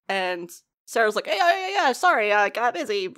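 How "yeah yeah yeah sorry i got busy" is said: mock breezy dismissal, quickly rattled off; jumpy, high-started casual brush-off. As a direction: wave the question away like it's nothing. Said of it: "trying to brush it off".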